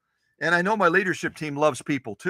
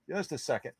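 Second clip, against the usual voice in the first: muttered much softer under the breath; soft aside to herself, not for the audience; quiet mutter to herself; 10 dB quieter than usual. Real, not acted. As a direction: drop to a private mumble while searching. Repeats request while searching.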